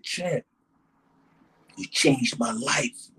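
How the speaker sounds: quiet, slow thanks to a hero; heartfelt gratitude. Retold or imitated. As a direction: say it hushed, from the heart. Grateful fan's confession.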